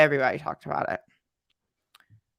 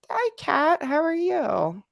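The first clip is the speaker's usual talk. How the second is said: excited greeting; 12 semitones higher, slower than usual. Real, not acted. Greeting a friend in chat.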